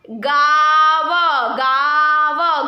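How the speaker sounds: गाव sung out slowly and loudly, nasal आ held; loud drawn-out demo word. Shown as a demonstration. Teacher calling out गाव to the class, loud and drawn out. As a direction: sing out गाव long and loud. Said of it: "गा के पीछे अ का pronounce".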